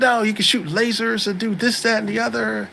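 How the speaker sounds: put-on high-pitched know-it-all girl voice; imitated nerd girl. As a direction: explain it in a mock girly high voice. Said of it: "She's just like".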